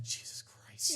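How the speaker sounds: hushed, voiceless words of melting love; melting whisper. Retold or imitated. Dad to his son, hushed and overwhelmed. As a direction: breathe it out, overwhelmed by the toddler. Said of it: "I was like".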